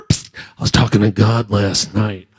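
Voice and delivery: low, hushed conspiratorial priest. Acted. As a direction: draw him in with a low secret. Priest calls him over.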